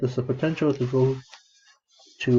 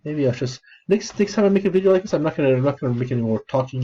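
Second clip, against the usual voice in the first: ordinary talk, nothing marked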